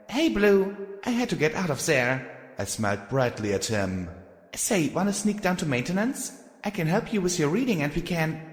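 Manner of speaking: put-on high, quick young filly. Filly character voice.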